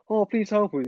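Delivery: high-pitched pretend cry for help; mock plea. Imagined people begging the Doctor for help, high mock plea. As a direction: plead like helpless victims, hammed-up. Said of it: "They're like".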